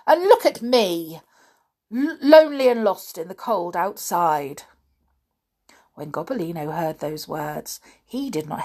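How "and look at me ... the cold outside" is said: louder, higher whining goblin; pitiful hobgoblin. A narrator's reading. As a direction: play for pity. Hobgoblin self-pity.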